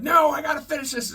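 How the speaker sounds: high, loud defiant protest; mock determined cry. Injured wrestler refusing to quit, high defiant cry. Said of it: "she's like".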